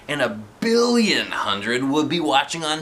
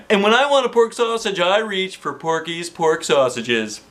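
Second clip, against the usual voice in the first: cheesy ad voice; 7 semitones higher than usual. Acted. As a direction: sell it like a TV commercial. Principal reads sausage ad.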